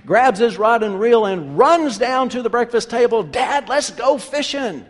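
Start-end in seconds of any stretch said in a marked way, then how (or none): 3.2-4.8 slow, higher, a hint of an excited kid; little boy calling his dad, lightly excited; lightly voiced eager boy. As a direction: sound like a boy ready to go. Eager boy calls to father.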